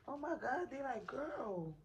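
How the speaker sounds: high, bright excited exclamation. Retold or imitated. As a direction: gush like an excited nurse. Nurses react excitedly to labor.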